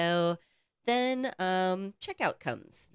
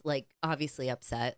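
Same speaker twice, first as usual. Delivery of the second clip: ordinary talk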